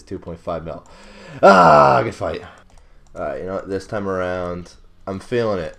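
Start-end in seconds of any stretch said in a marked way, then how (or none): 1.4-2.0 loud, higher-pitched "ah" outburst; frustrated yell. Real, not acted. Player who just lost millions in a fight, frustrated yell.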